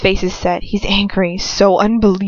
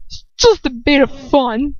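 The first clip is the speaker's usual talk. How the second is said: lightly voiced slurring heckler, pitched high; thick slurring man; 6 semitones higher, 3 dB louder than usual. A narrator's reading. Stranger brushing off Lucius's objection, lightly slurred man's voice. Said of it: "slurring man's voice I don't recognize".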